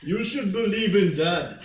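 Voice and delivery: Arnold Schwarzenegger. Acted. Game master as the brother Anton, Schwarzenegger-like accent. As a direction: boom it out in a Schwarzenegger-style accent. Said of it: "sound a little bit more like Arnold Schwarzenegger".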